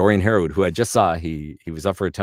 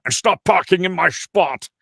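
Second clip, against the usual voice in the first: much higher, louder Connery voice; teasing Connery voicemail; leaving a prank message as Connery; Sean Connery; 12 semitones higher, 8 dB louder than usual. Acted. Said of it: "So I'm like".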